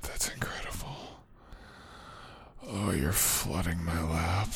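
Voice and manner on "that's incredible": whispering. Acted.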